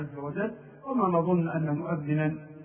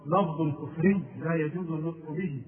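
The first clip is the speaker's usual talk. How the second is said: ordinary talk, nothing marked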